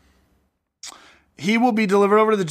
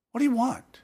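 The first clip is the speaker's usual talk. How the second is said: higher-pitched acted question; 7 semitones higher, 3 dB quieter than usual. Jesus addresses the beggar brought over, high-pitched question. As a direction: ask it in a raised pitch.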